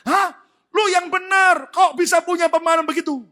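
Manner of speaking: high, loud cry of disbelief; shocked friend. Retold or imitated. Friend reacting to the grateful wife, shocked shout. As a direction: blurt it out, utterly startled. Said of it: "Temannya sampai kaget".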